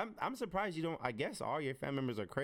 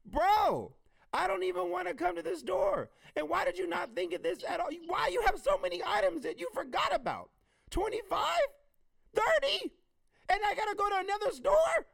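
high, loud, indignant outburst; hammed-up outrage, voice way up; exasperated rant; 6 dB louder than usual. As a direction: yell it like you've been pushed over the edge. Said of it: "that mess makes me insane".

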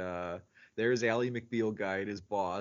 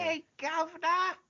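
much higher, hammed-up Cockney greeting; Cockney accent; 3 dB louder than usual. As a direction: chirp it like a stage Cockney. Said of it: "you've got your Cockney British".

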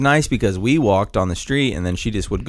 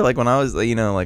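ordinary delivery

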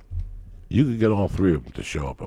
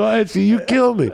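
amused high voice; 11 semitones higher, 4 dB louder than usual. Retold or imitated. Amused reaction quoted.

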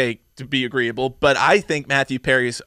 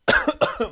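real choking cough; 7 semitones higher than usual. Real, not acted. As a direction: cough from something down the wrong pipe. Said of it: "Went down the wrong pipe".

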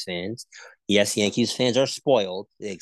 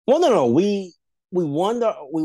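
high, loud "no, no" cutting in; sharp, raised denial; loud protest; 7 semitones higher, 4 dB louder than usual. Real, not acted. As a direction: jump in to shut the jab down. Protests the Yankees jab.

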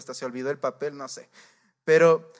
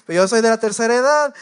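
louder, higher-pitched insistence, stretching "edad"; mock indignant yell; 5 semitones higher, 9 dB louder, faster than usual. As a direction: shout "tercera edad" to demand priority. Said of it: "No era de la tercera edad". (grito indignado, más fuerte y agudo)

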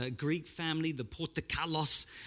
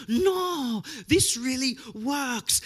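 put-on insistent Greek dad in a thick Greek accent, much higher and slow; 8 semitones higher, 4 dB louder, much slower than usual. The Greek dad insists Windex works, thick Greek accent. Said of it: "with his deep Greek accent".

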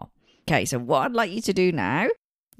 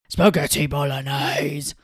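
slow, louder put-on voice; disguised voice; 2 semitones lower, 5 dB louder, slower than usual. A presenter recalls a teacher's disguised quiz voice, slow and loud.

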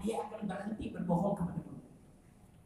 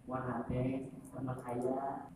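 sheepish confession; 7 semitones lower, 7 dB quieter than usual. Acted. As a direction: own up quietly as the cornered husband. Husband confesses affair to wife.

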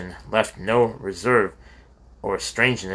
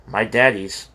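usual speaking voice

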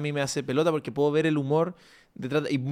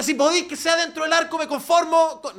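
mock coach; 12 semitones higher, 4 dB louder than usual. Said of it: "O sea".

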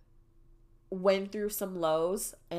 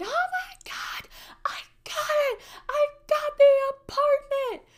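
high, squealed mock scream of joy; retold screaming; 5 dB louder than usual. Daughter in her car phones mom about the apartment, mock screaming. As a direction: squeal it like an overjoyed scream, far above your usual pitch. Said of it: "I'm sitting there screaming".

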